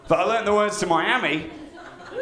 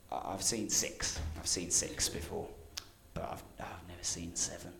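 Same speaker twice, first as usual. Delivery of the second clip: near-whispered mock confession, much softer; hushed confession; 11 semitones lower, 15 dB quieter than usual. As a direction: confess it under your breath. Will confessing in the sketch.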